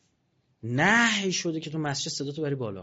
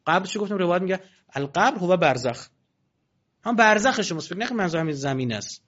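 usual speaking voice

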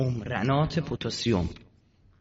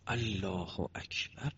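very soft, slow, distant takbir; hushed takbir; 5 semitones lower, 13 dB quieter, much slower than usual. Retold or imitated. Father praying alone in the palm grove, hushed slow takbir. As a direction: breathe it like a secret night prayer. Father's night prayer retold.

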